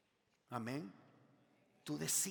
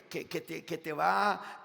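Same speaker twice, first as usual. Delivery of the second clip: ordinary talk, nothing marked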